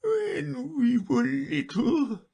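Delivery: high, slow, weepy turtle voice; a mournful old creature near tears; high slow lament; Mock Turtle.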